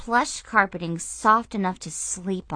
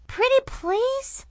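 put-on begging little girl voice; slower than usual.

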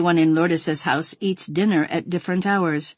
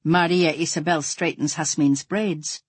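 ordinary delivery

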